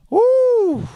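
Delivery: high, loud whoop of release; a sudden shrill cheer; excited whoop. Real, not acted. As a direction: let out a big woo after the rant. Shouts after finishing a rant.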